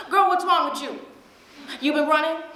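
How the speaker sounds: mother on the phone, high and loud; a mom sensing something's off, calling it out; suspicious mom. Acted. Mother on the phone to daughter, high suspicious demand. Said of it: "and she goes".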